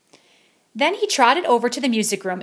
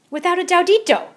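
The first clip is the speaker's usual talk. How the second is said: declaring; 10 semitones higher, 6 dB louder than usual. Acted. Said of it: "declared El Scapito".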